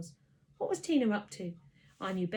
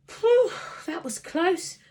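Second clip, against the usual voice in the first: relieved; 10 semitones higher, 10 dB louder, slower than usual. A narrator's reading. Relieved after escaping trouble.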